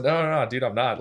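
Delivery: quick, high, loud protest; eager denial. Retold or imitated. Telling the pastor he isn't leaving, eager and loud. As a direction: rush to say you're staying.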